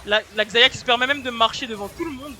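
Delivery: esports caster. Acted. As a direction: hype the play like a caster. Casts the fight live.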